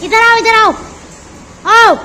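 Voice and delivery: loud call to come here. Real, not acted. Mother calling the children to come over, loud call.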